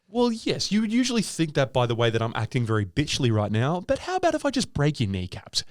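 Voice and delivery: sweet high-pitched politeness hiding a threat; nice voice. Acted. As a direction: deliver the threat ever so nicely. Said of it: "With the nice voice as well".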